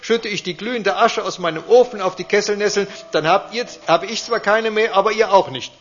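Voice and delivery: put-on old man shouting in anger; really angry. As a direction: warn them off his land. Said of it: "wird der Alte richtig böse".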